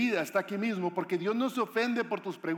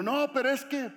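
protesting; 7 semitones higher than usual. Acted. Anxious believer objecting to God, protesting. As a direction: break in with a raised protest.

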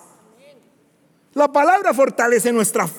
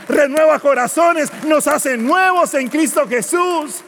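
louder, higher shouted sermon build; fiery pulpit shout; full-force preaching; 7 semitones higher, 5 dB louder than usual. Real, not acted. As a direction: preach it out to the whole church, loud and high.

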